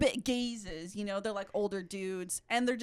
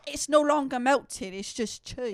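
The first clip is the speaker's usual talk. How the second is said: hammed-up British lament; mock British accent; 2 semitones higher, 6 dB louder than usual. Imitating the sandwich guy's lament, mock British accent. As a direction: mourn it in put-on British. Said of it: "making fun of accents".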